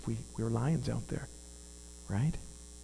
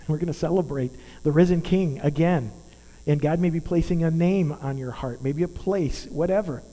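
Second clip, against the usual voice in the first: ordinary talk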